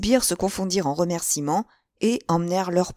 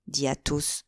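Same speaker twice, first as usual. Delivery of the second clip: ordinary delivery, nothing marked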